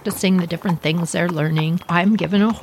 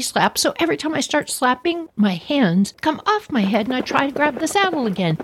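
ordinary talk

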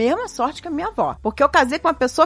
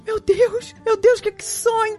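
high, breathless awe of a bride in tears; awed grandmother; 10 semitones higher, slower than usual. Retold or imitated. Grandma, tearful at her wedding, marvels in high awe. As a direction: gasp it out like a dream came true. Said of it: "olhava pro meu avô e falava assim".

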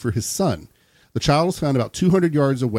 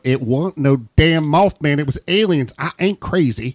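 slightly raised drawling protest; put-on country twang, defensive; indignant country witness; 3 semitones higher, 3 dB louder than usual. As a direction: protest like a rural eyewitness nobody believes. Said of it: "you can imagine going".

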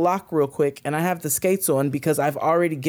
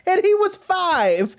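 laughing; 14 semitones higher, slower than usual. Real, not acted. Laughing at the kid story.

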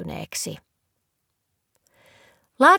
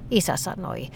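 plain reading voice

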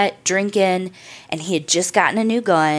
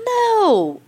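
high, drawn-out "no"; acted dismissive reply, pitched way up; 14 semitones higher than usual. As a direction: brush off the worry with a long, high no. Friend dismisses girlfriend's worry.

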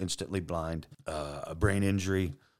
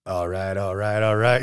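goofy McConaughey; 5 dB louder than usual. Retold or imitated. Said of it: "I'm just doing goofy shit".

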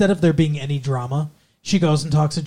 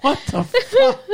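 squeaky, much higher, breaking into laughter; laughing cry. Real, not acted. Cracking up at the juicy tits line, high laughing shriek. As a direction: shriek it as the laugh takes over.

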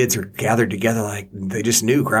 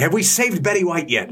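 mock anxious check; 8 semitones higher than usual. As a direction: play it as a mock urgent roll call. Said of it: "It's like".

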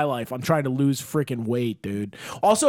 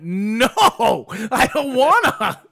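whiny refusal; 7 semitones higher, 6 dB louder, slower than usual. Retold or imitated. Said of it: "I went".

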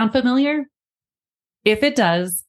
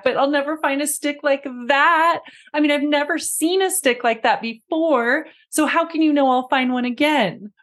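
put-on arguing child; 7 semitones higher than usual. Child arguing back through tears.

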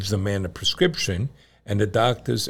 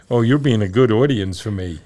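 ordinary talk

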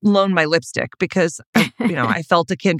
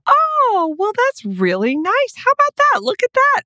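much higher, sing-song fake delight; mock-pleased surprise. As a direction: coo it like you're pretending to be charmed. Mock surprise at happy ending.

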